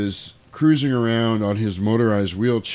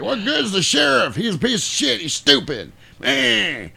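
high-pitched riled-up southern yokel; mock southern barroom griper; southern yokel accent; 11 semitones higher than usual. Yokel in the bar riling everybody up, southern accent. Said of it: "I love doing these southern yokel accents".

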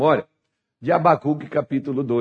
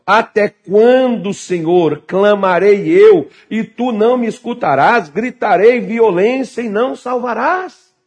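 loud, high, slow plea to God; desperate cry; 6 semitones higher, 5 dB louder than usual. Acted. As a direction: cry out, building in pitch toward desperation. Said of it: "chegando no ápice como se fosse um desespero". (clamor desesperado)

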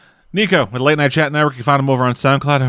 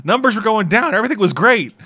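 exasperated Irish local; 8 semitones higher than usual. Retold or imitated. An Irish local ranting at visiting Americans, high mock exasperation. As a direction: voice a fed-up local venting at tourists, pitched up. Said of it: "It's like".

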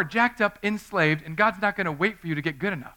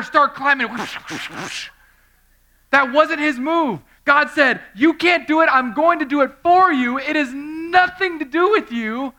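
loud, high, driving preacher voice; full-force preaching; 8 semitones higher, 8 dB louder than usual. Acted. Preacher proclaiming God's gift to the congregation, loud and high. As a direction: hammer the grace message home.

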